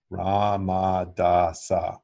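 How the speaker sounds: ra, ma, da, sa said slowly and evenly, one syllable per finger; mantra syllables. Shown as a demonstration. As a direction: demonstrate the second set syllable by syllable. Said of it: "got it?".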